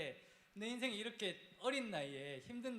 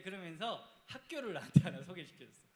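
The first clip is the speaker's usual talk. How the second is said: ordinary talk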